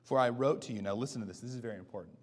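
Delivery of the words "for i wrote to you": scripture reading. Acted. Begins verse four reading.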